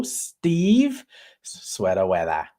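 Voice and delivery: put-on Boston accent, R's dropped; JFK-like accent. Host greeting viewers, botched Boston accent sounding like JFK. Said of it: "now I sound like J F K".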